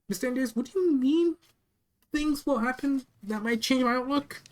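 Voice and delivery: high, anxious demand for an explanation; acted alarm, pitched well up; alarmed questioning. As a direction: question the boss in rising alarm.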